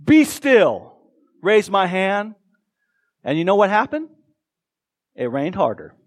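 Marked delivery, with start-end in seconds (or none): 0.0-0.9 loud, high wizard's command; Harry Potter. Retold or imitated. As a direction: cast it like a spell, mock-grand. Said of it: "in my best Harry Potter voice".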